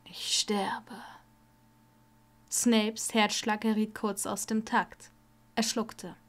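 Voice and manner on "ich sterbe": faint, breathless whisper; a last whisper; dying whisper. Acted. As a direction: barely get the words out, dying. Dying Malfoy gasps last words.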